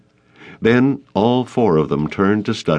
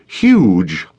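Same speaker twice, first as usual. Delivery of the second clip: angry outburst; 5 dB louder than usual. A narrator's reading. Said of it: "hear the anger in her voice".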